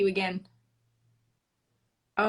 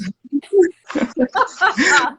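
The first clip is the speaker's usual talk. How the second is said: high, loud happy laugh; joyful laugh, falling away; delighted laugh; 8 semitones higher, 7 dB louder than usual. Real, not acted. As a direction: laugh out in relief. Laughing at joint success.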